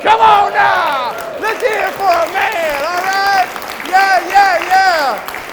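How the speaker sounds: high, loud, slow call to the crowd; rallying shout. Real, not acted. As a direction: get the crowd cheering. Rallying the audience.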